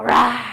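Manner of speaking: loud roaring lion sound. Shown as a demonstration. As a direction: roar out like a lion on the exhale. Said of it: "you're going to roar like a lion".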